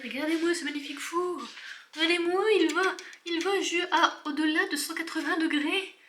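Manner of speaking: high-pitched, hammed-up admiration; gushing showing-off. Vlogger proudly presenting a modest oven to viewers, mock awe. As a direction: gush theatrically, pitched well up.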